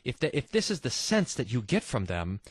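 usual speaking voice

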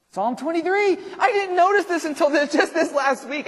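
high, loud burst of discovery; real amazed excitement. Real, not acted. Teacher sharing a fresh discovery with the class, excitedly. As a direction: burst out with the thrill of finding it.

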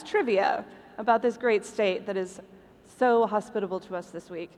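ordinary talk

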